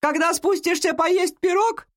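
high, sustained call from downstairs; granny's high, carrying singsong; grandmother calling. A narrator's reading. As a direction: holler a question up the stairs. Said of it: "крикнула она".

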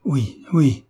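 lightly acted heartfelt exclamation; exclaiming. A narrator's reading. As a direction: cry out in eager agreement. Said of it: "s'écria le vieillard".

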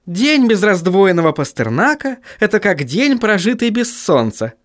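high-pitched, sugary female voice; honeyed, high-voiced politeness; very sweet voice. A narrator's reading. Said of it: "очень милым голосом произнесла Сахариса".

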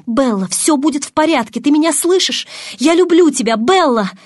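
high, rising emotional plea; urgent, desperate reassurance. A narrator's reading. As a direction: call to someone slipping away. Angel urgently calling dying girl.

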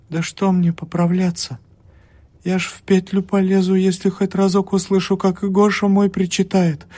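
high, strained despair; terrified, on the edge; desperate. A narrator's reading. Desperate sick woman, very high. (больная в отчаянии, высоким надрывным голосом)

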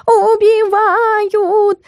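very high, long-drawn wail; hammed-up yowl for help; yelling cat. A narrator's reading. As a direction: wail it melodramatically, stretching the vowels. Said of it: "заорал Стикс".

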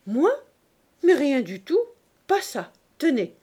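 high, loud, offended protest; indignant innocence. Acted. Fishwife denying she said anything, indignant innocence.

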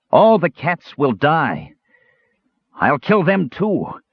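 slow, high-pitched creature threat; menacing kender. A narrator's reading. Possessed kender threatening to kill cats, slow high menace.

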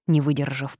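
ordinary delivery

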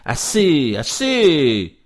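loud, high cry falling in pitch; theatrical, resonant shouted protest; vibrant outcry. A narrator's reading. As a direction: burst out ringingly, twice. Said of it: "d'une voix vibrante".

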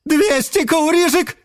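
rising, high auctioneer call; auctioneer closing. Acted. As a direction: build the count to sold. Auctioneer closes sale.

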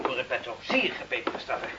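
whispered threat; gloating whisper. Acted. Lieutenant whispers the punishment to Carlo, gloating. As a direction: breathe the threat with relish.

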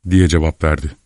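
plain reading voice

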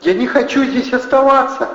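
very high plaintive female figure; pleading. A narrator's reading. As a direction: wail it high, begging. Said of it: "взмолилась Кристина Бонасюк". (жалобная мольба высоким голосом)

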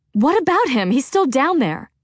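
distressed. A narrator's reading. Freya upset.

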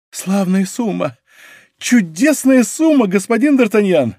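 growing rapture; delighted marvelling. Acted. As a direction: savour it in a high, amazed voice. Planchet marvels at the fortune.

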